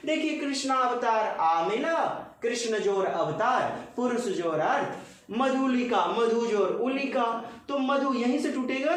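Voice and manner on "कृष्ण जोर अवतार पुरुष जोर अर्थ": ordinary talk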